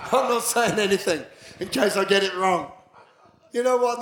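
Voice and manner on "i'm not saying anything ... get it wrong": a hint of a nervous churchgoer; mock nervous churchgoer. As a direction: voice them shy and unsure, lightly. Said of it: "people sit there and go".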